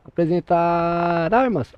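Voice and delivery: "Apresentar armas" slow, stretched, ending on a fall; drawn-out command. Shown as a demonstration. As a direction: call "Apresentar armas" slowly like on parade. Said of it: "Quando ele falar".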